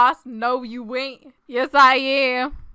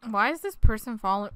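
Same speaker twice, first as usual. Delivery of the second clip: ordinary talk, nothing marked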